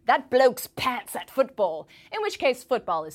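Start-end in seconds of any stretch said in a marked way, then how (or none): 0.1-1.9 slow, louder British-accent quip; hammed-up English bloke. Shown as a demonstration. A correspondent demonstrates a British insult, English accent. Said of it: "as in".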